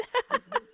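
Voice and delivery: a bright high laugh of relief. Real, not acted. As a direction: laugh as the word comes back. Laughs after recalling word.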